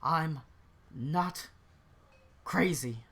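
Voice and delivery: worn-down, broken-up insistence; dejected denial. Acted. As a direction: say it to yourself with long gaps between words. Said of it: "sort of his head in his hand".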